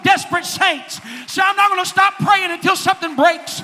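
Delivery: loud, fervent preaching. Acted. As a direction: preach it with full fire. Preaching at full force.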